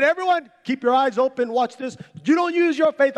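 high, loud call to the crowd; rally everyone to watch, full of bravado; showman preacher. Retold or imitated. Re-enacts showman addressing gathered crowd.

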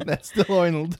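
said through laughter; laughing. Real, not acted. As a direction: laugh while saying it. Laughing at a failed impression.

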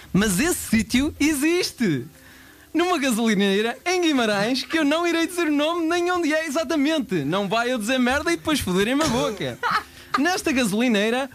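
upbeat commercial narrator; ad voiceover. Acted. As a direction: sell the gas station. Narrates gas station ad.

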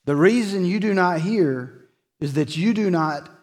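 Jesus confronting his accusers, preached high and loud; preaching at full force. Acted.

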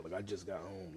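ordinary delivery

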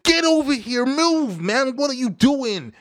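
high, barked keeper commands; imitating Alisson. Keeper Alisson shouting at his defenders, high mock command. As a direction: yell orders at defenders like a keeper. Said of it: "Alisson said".

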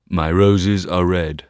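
put-on a proud old tree; rose tree voice. Red rose tree answers.

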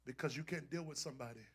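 soft, suspenseful sermon with long pauses; hushed preaching. Real, not acted. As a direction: drop to a hush and let every phrase hang. Hushed preaching after the climax.

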